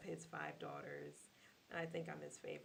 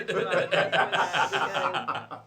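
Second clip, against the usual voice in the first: laughing; 18 dB louder than usual. Real, not acted. Laughing while cutting in.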